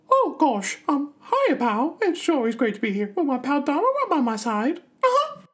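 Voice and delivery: very high, cheerful squeaky mouse falsetto; chirpy falsetto, like a beaming cartoon mouse greeting his pal; Mickey Mouse voice. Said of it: "who actually dominated that voice all those years ago".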